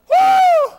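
high, loud revival whoop; joyful holler. Real, not acted. Preacher whooping after reading the verse, ecstatic. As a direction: let out a happy shout of praise.

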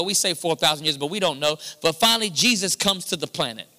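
usual speaking voice

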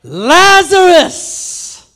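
high, very slow ringing call; Jesus calling Lazarus out of the grave, loud; clear loud call. Retold or imitated. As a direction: stretch the name out loud across the tomb. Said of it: "Jesus spoke in a clear voice".

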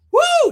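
loud high "woo" whoop; sudden high, loud holler of joy; ecstatic whoop. Real, not acted. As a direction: let out a piercing praise whoop. Preacher whoops mid sermon.

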